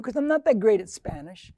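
normal talking voice

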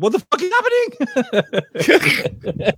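very high confused yelp; high panicked quote. Retold or imitated. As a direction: squeal it in confusion. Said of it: "He's like".

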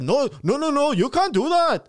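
loud, high imitated Indian accent. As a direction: scold like a clerk, in an Indian accent. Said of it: "the Indian guy that was running".

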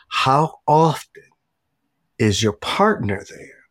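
deliberate, measured, with pauses between phrases; slow, pointed question. Retold or imitated. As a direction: retell the mentor pressing his point slowly. Mentor's question retold, slowly repeated.

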